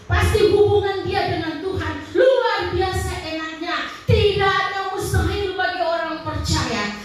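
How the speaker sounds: lantang dan bersemangat; khotbah berapi-api. Real, not acted. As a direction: dorong jemaat dengan suara keras. Preaching at full force on prayer. (pendeta menyemangati jemaat untuk berdoa, lantang)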